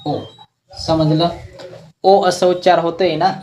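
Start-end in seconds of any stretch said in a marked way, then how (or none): 0.1-0.2 ओ said alone, long and rounded; vowel o shown. Shown as a demonstration. Teacher shows how the vowel mark reads, pure ओ. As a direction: hold a pure ओ. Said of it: "याला आपण असं वाचतो".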